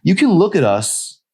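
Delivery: high, loud harsh demand; angry, hurt girl's voice. Acted. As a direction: throw it at the nurse harshly. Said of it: "It came out harsh".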